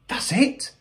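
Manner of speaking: high-pitched eureka cry; excited cry. A narrator's reading. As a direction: cry it out high, as if struck by an idea. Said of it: "cried Mo".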